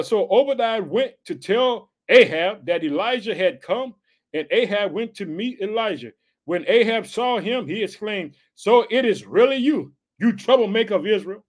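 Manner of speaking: scripture reading. Acted. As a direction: read the verse out to the flock, slowly and high.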